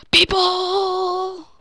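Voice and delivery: high, loud excited yell; excited shout. Real, not acted. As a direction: yell it out in triumph. Excited gaming kill boast.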